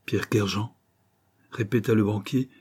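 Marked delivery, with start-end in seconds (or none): 0.1-0.8 startled echo. A narrator's reading. Banker recognises the ruined man's name, faintly startled. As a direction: repeat the name with a slight start. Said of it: "ne put réprimer un léger tressaillement".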